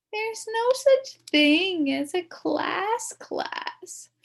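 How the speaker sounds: very slow, high scornful correction; girl contradicting a boy's fib; know-it-all scoff. Acted. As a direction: lecture him slowly.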